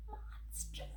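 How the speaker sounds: whispered dreaded word. Acted. Grover whispers monster to the kids. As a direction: hush the scary word.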